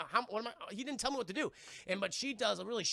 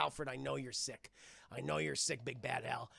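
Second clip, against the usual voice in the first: lower, softer caring voice; tender concern; 6 semitones lower, 6 dB quieter than usual. Retold or imitated. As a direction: gently comfort a dying butler. Bruce speaking to sick Alfred.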